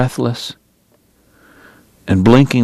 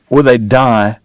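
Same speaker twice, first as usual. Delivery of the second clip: plain reading voice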